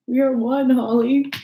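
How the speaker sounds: excited declaration to Holly. Real, not acted. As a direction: call it out with glee. Bonding with viewer over burps.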